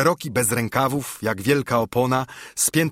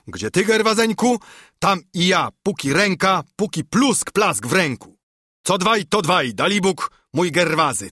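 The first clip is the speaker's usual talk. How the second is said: higher, lively voice for an eager comrade; spirited, raised-pitch character voice; animated Baptist; 6 semitones higher, 4 dB louder than usual. A narrator's reading. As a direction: pledge your support in bouncing phrases.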